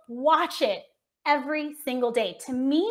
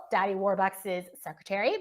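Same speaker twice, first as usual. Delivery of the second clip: ordinary talk, nothing marked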